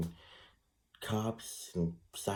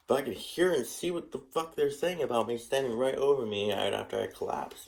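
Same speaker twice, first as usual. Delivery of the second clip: ordinary talk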